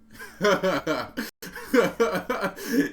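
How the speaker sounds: loud burst of real laughter; hearty laugh. Real, not acted. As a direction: laugh it out loud at your own story. Laughing after telling biker story.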